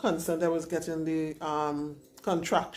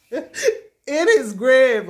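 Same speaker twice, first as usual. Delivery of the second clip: laughing; 6 semitones higher, 8 dB louder, much slower than usual. Real, not acted. Laughing at her own exaggeration.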